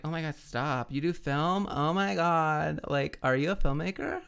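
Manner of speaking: put-on drawn-out Valley Girl gushing; mock an impressed Valley Girl; Valley Girl accent. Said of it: "The Valley Girl accent".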